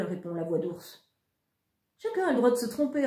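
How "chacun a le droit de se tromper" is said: quick, cheeky comeback; cheeky retort. A narrator's reading. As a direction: snap it back fast and cheeky. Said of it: "rétorque Vincent". (réplique rapide et insolente)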